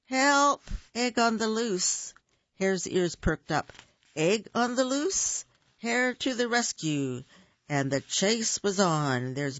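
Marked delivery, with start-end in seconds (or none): 0.1-2.1 urgent high call; calling for help. Acted. Bear calls for help. 4.1-7.2 lightly higher, eager hero voice; a hint of a bold rescuer; lightly voiced eager hare. Hare answers the call.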